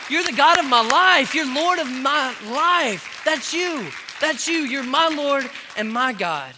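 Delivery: preacher leading shouted worship; loud praise. Acted. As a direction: lead the church in jubilant praise. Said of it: "celebrate with me and say".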